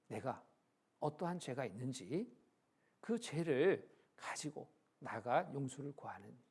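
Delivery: normal talking voice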